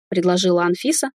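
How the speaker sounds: plain reading voice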